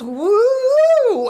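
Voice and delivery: high, loud "woo"; imitated woozy whoop. Medium showing how he sounded intoxicated, whooping. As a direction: whoop like someone high or drunk. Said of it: "Why are you talking like this?".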